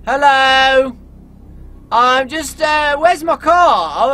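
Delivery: loud, high call into the dark woods. Acted. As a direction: shout for a passing car. Calling for a ride in woods.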